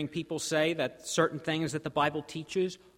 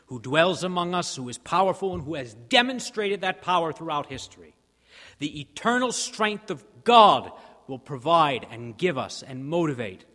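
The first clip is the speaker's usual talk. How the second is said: rising preaching; 3 semitones higher, 8 dB louder than usual. Real, not acted. Preacher builds to proclaim God's power.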